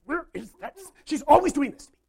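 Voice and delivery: high, loud exasperated grumble; fuming Martha. Acted. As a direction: vent like a frustrated sister. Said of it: "she just starts fuming and getting more and more upset".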